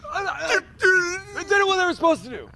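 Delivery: high, loud whimpering coach; Ron Zook. Acted. Coach defending his mistake to reporters, high mock-tearful protest. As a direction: plead in a hammed-up strangled voice, stopping and starting. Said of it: "It's hard to be Ron Zook".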